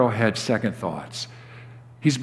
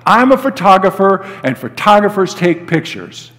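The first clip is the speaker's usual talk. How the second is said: higher, louder, impatient explaining; exasperated exclamation; 6 semitones higher, 4 dB louder than usual. A narrator's reading. Photographer telling the pilot why he needs passes, exasperated exclamation. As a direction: spell out the obvious, exasperated. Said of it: "exclaimed the photographer".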